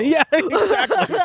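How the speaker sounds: high laughing yes, stretched out slowly. Real, not acted. Co-host laughing at his own axe joke, high laughing. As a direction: laugh the agreement out high and slow.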